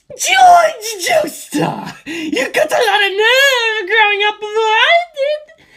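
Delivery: shrieking villain, stretched out; shouted taunt. Acted. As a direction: scream it at him as you fly. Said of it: "he shouted as he flew".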